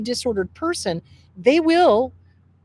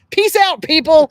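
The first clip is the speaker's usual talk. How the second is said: high, loud call-out; loud sign-off; 5 semitones higher, 9 dB louder than usual. Real, not acted. Host ending show before lightning, loud high farewell. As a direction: call it out to the crowd.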